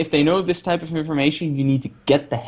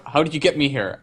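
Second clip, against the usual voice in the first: ordinary talk, nothing marked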